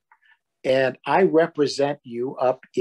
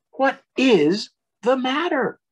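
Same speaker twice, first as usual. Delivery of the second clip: higher, caring parent question; Large, concerned; 11 semitones higher than usual. Acted. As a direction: ask it high and gently like a worried parent. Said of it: "said Large".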